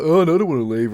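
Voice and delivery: mock their stubborn refusal to go; put-on bratty protest; mocking dickhead. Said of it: "they're just dickheads like".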